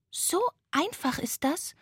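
high ghost question, rising at the end; tired little ghost. A narrator's reading. Tired ghost asking the sloth, high questioning voice. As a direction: ask in wonder as a small high ghost. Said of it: "fragte das müde Gespenst".

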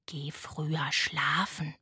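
low, slow, soft owl voice; owl advising. A narrator's reading. Owl advising the tired ghost, low slow voice. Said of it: "riet der Uhu".

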